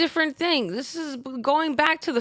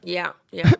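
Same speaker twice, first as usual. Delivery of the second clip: ordinary talk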